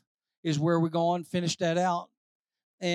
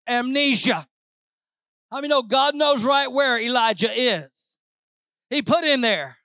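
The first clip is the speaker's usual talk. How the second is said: loud, raised full-force preaching; rising Pentecostal preaching; 5 semitones higher, 5 dB louder than usual. Acted. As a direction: preach it to the rafters.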